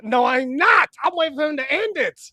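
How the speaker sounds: loud protest. Real, not acted. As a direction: yell back your denial. Shouted denial to co-host.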